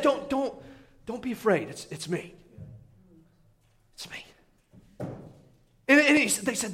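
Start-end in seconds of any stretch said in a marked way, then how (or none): 0.0-4.3 softer, hushed and calming; gentle reassurance. Acted. Jesus calming his scared disciples on the water, soft and reassuring. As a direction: soothe them, barely above a breath.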